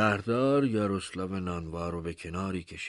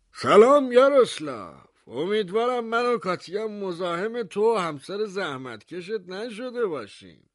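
high, polite voice of the town mayor; mayor's voice; 14 semitones higher, 8 dB louder than usual. Acted. Mayor greeting the baker at his door, high and courteous. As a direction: greet the host with showy courtesy, pitched high.

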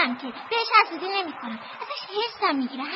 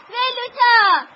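higher, louder call of the name; louder, higher second call to Velota; eager shout; 5 semitones higher, 9 dB louder, much slower than usual. Real, not acted. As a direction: shout her name happily. Kids run to greet Velota.

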